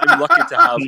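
high and loud, breaking into laughter; laughing. Real, not acted. To the host, laughing at his own granny claim. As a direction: laugh through the words.

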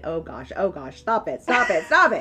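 flustered teacher begging her to stop crying; mock panic. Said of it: "It's like deer in the headlight".

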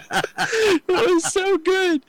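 high squeaky laugh through the words, slow; can barely get it out for laughing. Real, not acted. Laughing hard at his own flute joke to a friend, slow high laughter.

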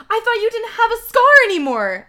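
high, very loud melodramatic shout; hammed-up yelled protest. A narrator's reading. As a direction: shout it in disbelief. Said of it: "I shouted".

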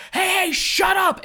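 put-on high, loud yell to silence the kids; shout over the table. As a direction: cut through the bickering with a yell. Kids bickering, Will yells over.

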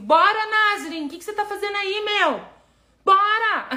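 high, loud shout across the room; calling out. Real, not acted. Urging a friend to join the book club, loud high call. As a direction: call her in loudly and playfully.

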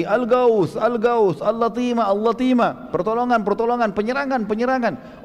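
high-pitched mock alarm chant; imitated repeated shouting. Damdam enters Mecca's gate crying alarm, mock shouting. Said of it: "teriak lagi".

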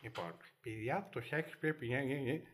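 droning teacher. Retold or imitated. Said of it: "αρχίζει και σου λέει".

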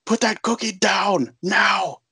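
hammed-up Arnold roaring over the cookie; furious shout. [name] yelling at Ted over the phone, hammed-up roar. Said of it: "my terrible Arnold impression here".

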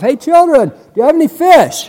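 high-pitched call across the water. Acted. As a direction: call out to people far off. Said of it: "they holler back across the lake".

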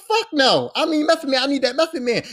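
exasperated insisting. Retold or imitated. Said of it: "I was like".

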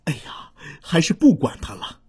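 soft unvoiced whisper to himself; whispered thought. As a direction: whisper it like a secret decision. Little pterosaur's private thought. (小声的耳语)

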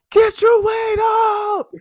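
high, loud, slow drawn-out shout; taunting a fat opponent, mock yell; imitated yell. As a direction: holler it across the court. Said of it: "he just yells".

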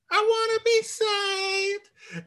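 fake-sob it like a patient begging to be saved; mock crying plea.

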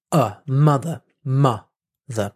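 "mother" broken down with a strong first syllable; first-syllable stress. Shown as a demonstration. Teacher breaking down "mother" for learners, stressing its first syllable. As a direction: punch the "mo" of "mother", let "ther" fall away. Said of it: "the stress is on the first syllable".

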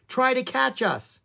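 high-pitched goading call; taunting yell. A narrator's reading. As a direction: call out high, daring a chase. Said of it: "yelled Annie".

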